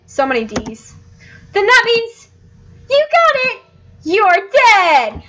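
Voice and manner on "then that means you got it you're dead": very high, gleeful character voice; absurdly cheerful. Acted. Heaven's dog telling newcomer of death, high and cheerful. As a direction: announce it brightly, high and bubbly. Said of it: "she just says it so cheerfully".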